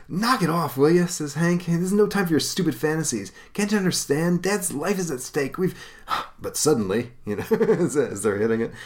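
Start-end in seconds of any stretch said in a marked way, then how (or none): 0.1-5.7 put-on much higher, fast, annoyed young voice; scolding. Hank scolding Don for fantasies, annoyed. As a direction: snap at your dreamy brother.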